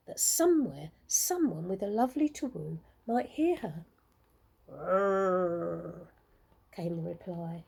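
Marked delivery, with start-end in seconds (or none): 4.7-6.1 low, long bear growl. A narrator's reading. Bear answers.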